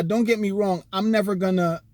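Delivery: usual speaking voice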